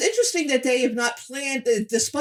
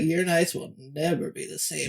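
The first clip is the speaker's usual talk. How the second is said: low sexy voice; 5 semitones lower, 5 dB quieter than usual. Retold or imitated. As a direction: lower register, trying to sound sexy. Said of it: "in that sort of lower register, "I'm trying to be sexy" voice".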